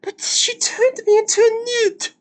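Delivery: high, strained mock-indignant squeal; indignant villager. Streamer quoting the newt line, high strained mock complaint. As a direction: protest like an aggrieved peasant.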